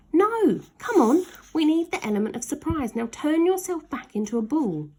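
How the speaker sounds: he can't wait to pounce on his sister; eager urging. Acted. Ned urging Slime to roll at his sister, eager and excited.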